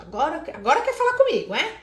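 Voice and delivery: put-on mother still sulking, snapping at her child; sulky sarcastic mother. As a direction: throw it back at the child, arms crossed. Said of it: "de braço cruzado e fala".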